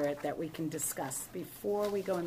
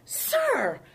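higher, louder pointed question; tough, pitched-up demand; firm confrontation; 9 semitones higher, 7 dB louder, much slower than usual. Retold or imitated. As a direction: challenge the man sharply, voice raised. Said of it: "I got a little like".

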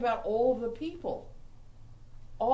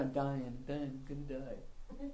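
mock dying whine; 6 semitones lower, 8 dB quieter than usual. Mock whining of self-pity.

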